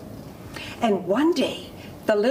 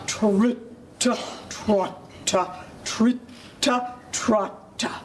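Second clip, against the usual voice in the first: very slow, separate syllables; heavy, slow trit-trot; big goat hoofbeats; much slower than usual. A narrator's reading. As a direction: plod out each hoof step in words. Big goat's heavy hooves on bridge.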